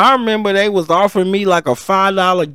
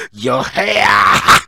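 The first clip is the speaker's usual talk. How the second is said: deep booming preacher repeat; 6 semitones lower, much slower than usual. Acted. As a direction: preach it like from the pulpit. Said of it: "I had to put my preacher voice in".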